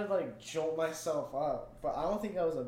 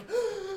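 sharp scared gasp. Retold or imitated. Jump scare retold.

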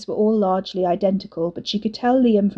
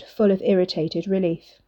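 ordinary delivery, nothing marked

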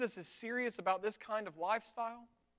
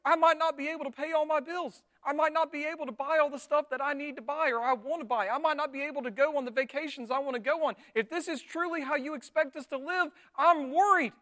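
louder, higher acted fretting; freaking out in protest; worried pleading; 4 semitones higher, 4 dB louder than usual. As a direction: pile up the worries anxiously. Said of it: "We're worried".

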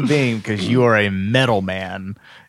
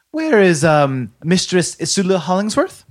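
officious woman voice; 6 semitones higher than usual. Acted. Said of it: "she looks at her ledger".

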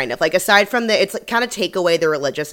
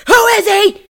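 high, loud acted screaming; furious scream; 14 semitones higher, 9 dB louder than usual. Lois screams at Jennifer for his name, furious, full-force. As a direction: scream it out, stretching the final insult. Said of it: "and she screams".

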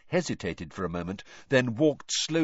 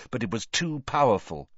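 ordinary delivery, nothing marked

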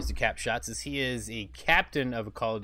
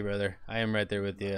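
ordinary talk, nothing marked

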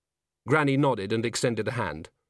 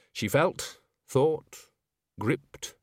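usual speaking voice

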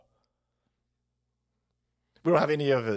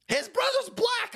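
mock voice. High slow put-on voice.

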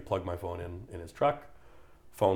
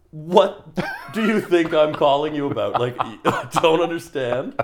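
loud, high-pitched retold rant; incredulous mock tirade; exasperated outburst; 8 semitones higher, 9 dB louder than usual. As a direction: blow up in disbelief, half laughing. Acted outburst at insurance agent.

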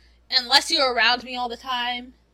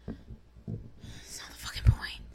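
low hoarse whisper; whispering annoyed to cousin on phone; 7 dB quieter than usual. Retold or imitated. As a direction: hiss the refusal under your breath.

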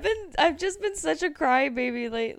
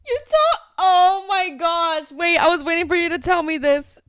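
loud, high-pitched thrilled reaction; real excitement, loud and stretched on the gasp of recognition; excited outburst; 4 semitones higher, 9 dB louder than usual. Real, not acted. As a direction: burst in, bursting to hear the gossip. Excited reaction to friend's gossip.

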